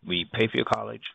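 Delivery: ordinary delivery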